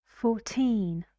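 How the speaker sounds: put-on Fourteen with the weight on the last syllable, teen; Fourteen, teen stressed and long. Shown as a demonstration. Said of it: "see the difference".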